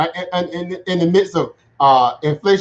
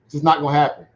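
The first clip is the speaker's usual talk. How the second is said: ordinary talk, nothing marked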